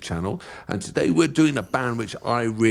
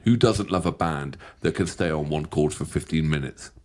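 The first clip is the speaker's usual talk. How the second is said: usual speaking voice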